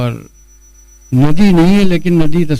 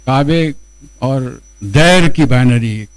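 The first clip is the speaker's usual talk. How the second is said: ordinary talk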